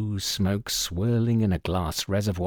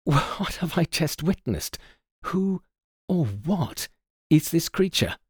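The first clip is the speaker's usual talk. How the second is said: higher, halting, bewildered question; baffled assistant, broken phrasing; flustered stammer; 6 semitones higher than usual. A narrator's reading. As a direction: grope for the words in pauses. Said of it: "I stammered".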